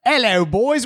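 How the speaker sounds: put-on hammy British "Hello, boys."; reading a listener's greeting in a put-on British voice; mock British greeting. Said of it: "Joel's from foggy London town".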